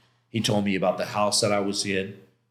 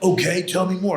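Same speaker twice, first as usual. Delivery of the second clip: impatient, rising demand, higher and louder; a touch of angry impatience; getting angry; 8 semitones higher, 4 dB louder than usual. Retold or imitated. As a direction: push him, irritation creeping in. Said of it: "And I'm getting angry".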